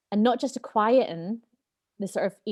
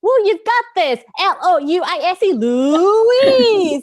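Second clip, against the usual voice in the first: much higher, much louder cheering with laughs; American cheerleader; 10 semitones higher, 10 dB louder, slower than usual. Retold or imitated. The inner cheerleader rooting for Louise, high loud cheer. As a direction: chant her name like a cheer squad. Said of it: "an American cheerleader".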